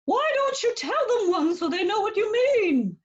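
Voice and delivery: the puppet pipes up with a question. Acted.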